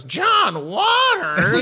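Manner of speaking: high, slow, incredulous cartoon mom; Marge Simpson, incredulous. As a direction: draw the name out high in mock disbelief. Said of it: "Marge incredulously looks over and goes".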